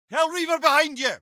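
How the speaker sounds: high, strained call; combat warning call. Acted. Head warns his brother of a Hellreaver, high strained cry. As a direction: cry out the enemy's name in alarm.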